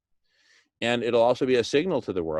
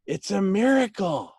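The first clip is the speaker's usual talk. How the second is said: excited echo; 9 semitones higher, 4 dB louder than usual. Retold or imitated. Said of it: "they were just saying".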